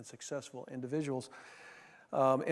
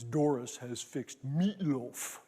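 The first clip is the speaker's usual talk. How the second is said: very slow, imitated Austrian accent; much slower than usual. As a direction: savor each word in his Austrian accent. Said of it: "Peter had this delightful Austrian accent".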